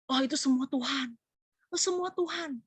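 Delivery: lightly voiced pious deflection, rising high; a hint of a falsely humble singer brushing off praise; mock false humility. Preacher mocks a falsely humble singer, lightly voiced, high. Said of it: "Tapi kita nggak usah terlalu".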